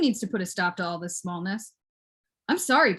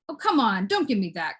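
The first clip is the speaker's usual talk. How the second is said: ordinary talk, nothing marked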